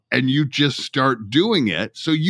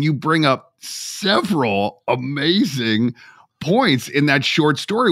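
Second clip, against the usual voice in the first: ordinary talk, nothing marked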